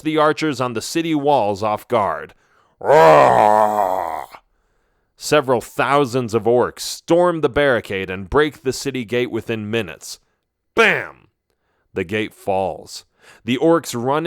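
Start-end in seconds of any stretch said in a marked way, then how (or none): 2.8-4.3 loud beastly roar; monster roar. A narrator's reading. Orcs attack the city. 10.7-11.3 loud bam. A narrator's reading. Gate smashed.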